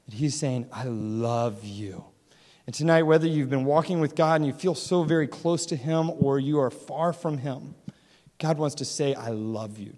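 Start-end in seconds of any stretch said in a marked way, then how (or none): 0.7-2.0 slow, low, soft declaration; tender. Acted. God's words voiced tenderly. 9.1-9.9 soft tender. Acted. God's words voiced tenderly.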